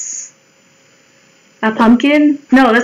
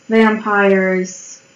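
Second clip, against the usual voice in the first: normal talking voice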